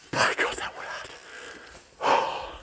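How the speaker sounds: whispered, worn out from the climb; breathless whisper. Real, not acted. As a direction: breathe it out, spent.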